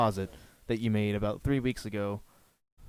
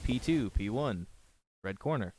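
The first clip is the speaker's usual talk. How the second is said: slow flat callout; 5 dB quieter than usual. Shown as a demonstration. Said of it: "You just sound emotionless".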